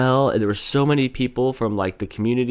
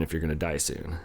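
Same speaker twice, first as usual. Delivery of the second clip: whispered joking aside; whispering; 6 semitones lower, 10 dB quieter than usual. Real, not acted. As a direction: drop to a whisper.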